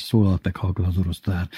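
usual speaking voice

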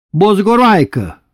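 high, loud, slow bellowed slur; drunken yell. Retold or imitated. Drunk father shouting at his wife, loud yell. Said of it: "ezt szokta ordítani az édesapja".

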